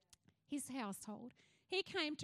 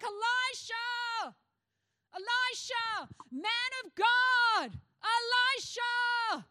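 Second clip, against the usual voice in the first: loud, slow, pleading cries, pitched up; crying out; 7 semitones higher, 9 dB louder, slower than usual. Acted. Widow desperately crying out to the prophet Elisha, loud and slow.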